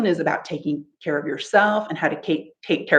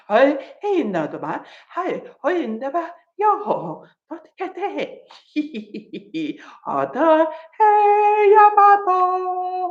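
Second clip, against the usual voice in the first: playful high babble, some bits fast; silly gibberish, fast and high; laughter yoga gibberish; 7 semitones higher than usual. Shown as a demonstration. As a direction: chatter nonsense with a laugh. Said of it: "practicing that gibberish".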